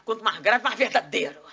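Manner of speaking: loud mock-solemn orator voice; booming authority. Shown as a demonstration. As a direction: boom it out as the sound of truth. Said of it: "Qual é o som do verdadeiro?".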